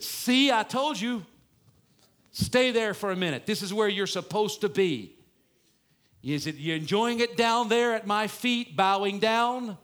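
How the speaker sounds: loud, high, mocking gloat. Pastor pretending to be Joseph taunting his bowing brothers, loud gloating. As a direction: rub it in, lording it over them. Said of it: "I would have gloated".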